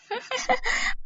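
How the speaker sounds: high, soft greedy chuckle; gloating laugh. Acted. Babli gloats over coming riches, high gloating chuckle.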